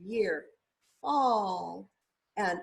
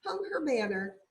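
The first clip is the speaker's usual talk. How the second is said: plain reading voice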